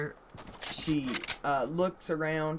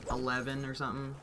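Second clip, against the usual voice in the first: ordinary talk, nothing marked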